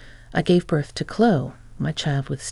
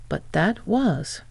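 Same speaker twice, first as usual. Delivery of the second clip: slow, halting start of an excuse that dies off; trailing protest; 3 semitones higher, slower than usual. Acted. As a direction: Mike starts to defend himself and gives up, slowly trailing off. Cut off mid-protest, trailing.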